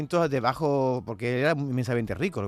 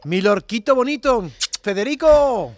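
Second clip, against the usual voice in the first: saludo cariñoso, alto y pausado; llama a Federico con cariño; Dalí cariñoso; 6 semitones higher, 7 dB louder, much slower than usual. Acted. Dalí greets Lorca fondly. (Dalí afectuoso)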